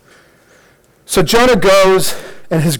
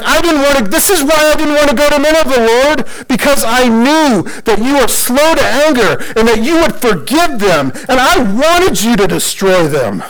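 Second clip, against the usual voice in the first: high, indignant rant at the Lord; hurried mock protest, easing down toward the end; angry Jonah; 7 semitones higher than usual. Jonah complaining to God that he spared Nineveh, angry. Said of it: "he's just fuming. He's mad".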